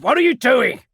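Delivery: high loud panicked demand. Acted. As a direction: let panic crack the command. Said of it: "Something like panic was in his voice".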